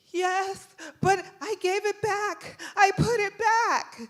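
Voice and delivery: put-on high, loud, whiny kid voice, drawn out; protesting child. Son defends himself to the officer, high loud protest. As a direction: plead your case like a little kid.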